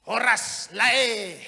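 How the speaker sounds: loud slow Batak horas lae; Batak greeting. Retold or imitated. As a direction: call out a Batak horas to a friend. Drunk Batak man greets Noah.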